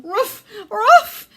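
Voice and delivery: high loud yapping barks; high warning barks. A narrator's reading. Two little dogs warning their owner with loud yaps. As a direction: yap an urgent warning. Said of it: "they warned".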